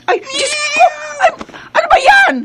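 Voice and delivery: shrieking high in sudden fright; a mother jolted by a scare, crying out; high frightened outcry; startled scream. Acted.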